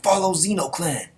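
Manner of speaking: rally the soldiers to push through; rallying the troops. Acted. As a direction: general urging troops forward, building to a shout. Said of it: "Mangekyo was like".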